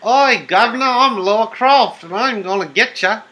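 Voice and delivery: loud, high, hammed-up British accent; bad British accent. Player hamming up Laura Croft in bad British accent. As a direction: play a cheeky, badly faked Brit. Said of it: "trying to do a, a British accent".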